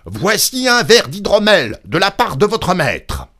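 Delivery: loud, high, insistent holler; exhausting shout. A narrator's reading. Innkeeper straining to reach the unmoving woman, hollering. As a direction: shout each phrase with all your might. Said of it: "il avait beau s'épuiser à crier".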